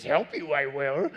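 lightly voiced Yoda, barely changed; a hint of Yoda's inverted croak. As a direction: son doing his Yoda for Dad. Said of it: "he does a great Yoda".

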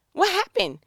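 high, loud incredulous what happened?. Acted. Speaker to the irritated spouse, high incredulous question. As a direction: throw up your hands in disbelief. Asking what went wrong.

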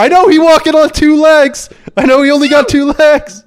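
mocking alligator. The alligator mocking the two-legged human, high and loud. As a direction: squeak it out as a sneering alligator. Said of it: "it's like".

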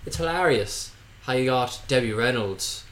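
normal talking voice

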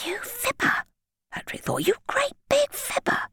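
put-on boy silently calling the lollipop man a liar; whispered thought. A narrator's reading. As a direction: hiss it inside your head. Boy's silent indignant thought.

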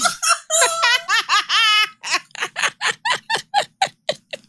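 long, high-pitched cackling laugh. Real, not acted. Co-host cracks up at her own joke, high laughing. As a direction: crack up and let it trail on in bursts.

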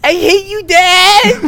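put-on high, loud teen outburst; shouting. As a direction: scream it like a bratty kid. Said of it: "Say it".

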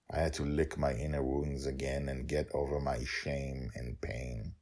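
ordinary delivery, nothing marked

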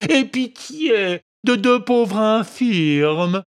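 high, slow whining beg; sly fox whimpering high and slow for pity; whining plea. A narrator's reading. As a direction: plead like a pitiful beggar. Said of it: "d'une voix pleurnicharde".

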